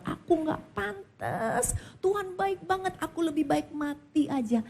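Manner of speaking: a broken man confessing he is unworthy; tearful confession. Retold or imitated. Husband broken before Jesus. (suami menangis lirih mengaku tak layak)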